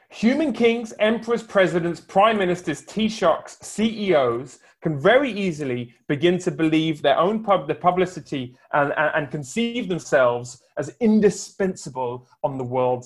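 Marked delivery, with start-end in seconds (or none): none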